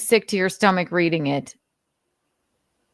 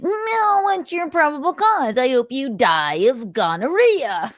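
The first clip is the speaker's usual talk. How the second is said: much higher, whiny mock-indignant voice; mocking impression; 8 semitones higher than usual. Host imagines suspect taunting police, high whiny mockery. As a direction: play a petulant suspect sneering at cops.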